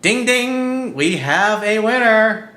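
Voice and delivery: high, loud, drawn-out announcer call; mock winner announcement. Host calling out that we have a winner, mock announcement. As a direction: ring it out like a game-show bell.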